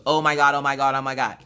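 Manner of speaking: loud, rapid-fire repeated exclamation; hammed-up overjoyed outburst; imitated excitement. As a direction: gush like a husband beside himself. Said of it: "be excited".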